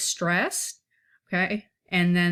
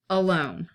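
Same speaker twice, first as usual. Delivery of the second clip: ALONE with a light first syllable, weight on LONE; unstressed then stressed. Shown as a demonstration. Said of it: "an unstressed and a stressed".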